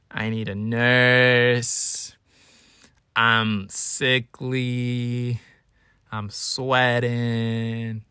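drawn-out, hammed-up invalid moan; drama-king sickness. Self-declared drama king announcing he is sick, hammed-up and drawn out. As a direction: drag every word out like a melodramatic patient. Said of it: "I'm a drama king".

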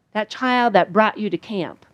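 ordinary talk, nothing marked

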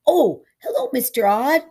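put-on high, loud boyish hello; bright greeting. A narrator's reading. Charlie greets Odd as he turns, loud greeting. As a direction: call it out in pleased surprise. Boy greets a passing man.

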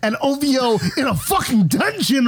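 high-pitched, incredulous ranting; worked-up rant. Real, not acted. Co-host arguing [name] uses ghostwriters, worked-up high rant. Mock outrage about ghostwriters.

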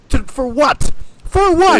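high, loud for what; outraged cry. Real, not acted. Fan ranting about a pointless elimination, loud and high. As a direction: shout it in disbelief.

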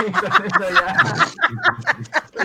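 high, slow laughing words. Real, not acted. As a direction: laugh through the line. Laughing at own joke.

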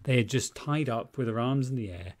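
ordinary delivery

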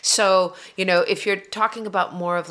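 ordinary talk

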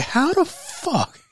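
high, loud, laughing incredulity; can't get over it, laugh through the outrage; incredulous high-pitched cracking up; laughing in disbelief. Real, not acted. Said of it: "I'm just over here just laughing".

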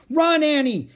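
urgent high, loud yell; crying out. A narrator's reading. Jack warns Annie as the jaguar leaps away, crying out. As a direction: shout an urgent warning. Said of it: "cried Jack".

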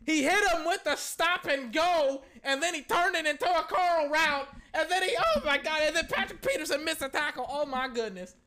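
high, loud disbelieving yelps at the play; excited shouting. Real, not acted. Fan yelling at the TV in loud excitement. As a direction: lose it over a huge play. Watching a big play live.

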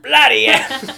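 loud, high hammed-up Cockney yell; Cockney shout. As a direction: bellow it like a stage Londoner. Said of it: "no fucking way I'm doing an accent".

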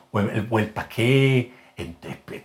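a slow, dragged Medellín "pa' qué"; paisa accent. Shown as a demonstration. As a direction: drag the words out in a thick paisa accent. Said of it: "empiezo a arrastrar mucho". (acento paisa arrastrado y lento)

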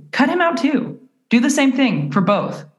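high, emphatic friend's advice; imitating a friend. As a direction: lightly mimic a friend insisting. Friend's blunt advice, retold.

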